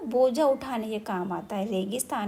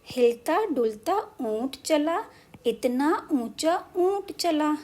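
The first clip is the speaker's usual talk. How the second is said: poem recitation; 4 semitones higher, slower than usual. Shown as a demonstration. Reciting poem lines slowly.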